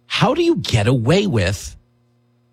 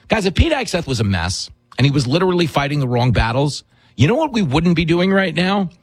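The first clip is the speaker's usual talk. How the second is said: ordinary delivery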